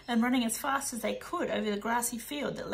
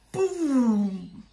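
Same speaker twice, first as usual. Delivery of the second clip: boom; 9 dB louder than usual. Acted. Explosion sound effect.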